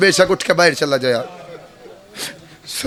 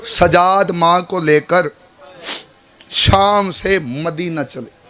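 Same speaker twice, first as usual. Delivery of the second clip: drawn-out, grieving narration; slow mournful telling; 3 semitones lower, slower than usual. Acted. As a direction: stretch every word out in sorrow.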